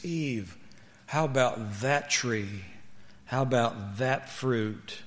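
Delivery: soft, slow serpent temptation; tempting devilish whisper. Acted. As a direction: coax her gently like the serpent. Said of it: "whispered the serpent".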